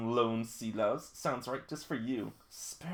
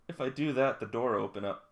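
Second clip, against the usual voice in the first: usual speaking voice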